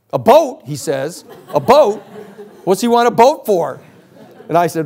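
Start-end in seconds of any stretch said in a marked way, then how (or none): 0.1-3.8 high, loud incredulous repeat with a laugh; astonished disbelief. Retold or imitated. Friend hearing about the boat, loud astonished disbelief.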